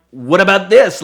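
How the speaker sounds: spring the find on him like a trap; triumphant exclaim. Retold or imitated. Officer waving the find at the driver, loud triumphant gotcha. Finds gram, confronts driver.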